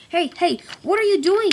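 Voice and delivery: high, loud alarmed cry. Acted. Bert crying out as Colin lifts him. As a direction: yell in panic. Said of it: "cried Bert".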